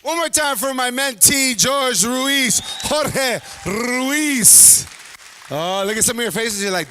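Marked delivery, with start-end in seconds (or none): 0.0-4.9 big drawn-out shout on the name; stage announcer. Real, not acted. MC bringing George Ruiz to the stage, announcer shout. MC introduces the next comedian.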